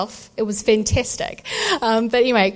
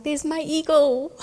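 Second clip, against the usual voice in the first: slow, higher hushed wonder at eagle; awed murmur; 6 semitones higher than usual. Retold or imitated. Mother recognising God's eagle, hushed and awed. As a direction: breathe it in amazement.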